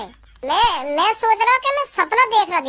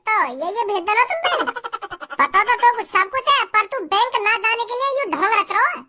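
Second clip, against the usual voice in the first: normal talking voice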